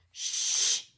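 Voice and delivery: sharp unvoiced hiss; hissed shush. McGonagall hushing Hagrid, hissed shush. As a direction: hush him fast. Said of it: "hissed Professor McGonagall".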